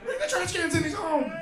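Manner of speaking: put-on high-pitched shouted kid alarm; hammed-up panicked squeal; mock panic. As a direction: imitate scared kids scrambling before dad arrives. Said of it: "if you didn't get scared".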